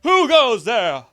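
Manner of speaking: high, loud, slow challenge into the dark; hammed-up bravado, dragging it out; mock tough guard. Mock-guarding his house against a robber, loud and hammy. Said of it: "I'd be like".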